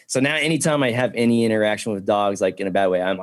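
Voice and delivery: usual speaking voice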